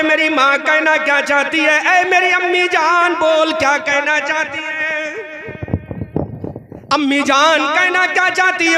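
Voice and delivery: loud, high sermon vow; fervent preaching. Acted. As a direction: vow it out to the crowd. (जोशीली तक़रीर में वादा)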